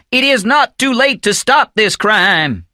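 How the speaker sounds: high, drawn-out shouted plea; impassioned high-pitched shout. A narrator's reading. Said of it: "stepped forward and started shouting".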